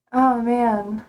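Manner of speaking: ordinary talk, nothing marked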